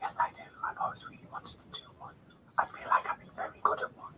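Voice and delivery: soft ASMR whisper. Real, not acted. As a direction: hush it right down. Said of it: "I was quite enjoying whispering".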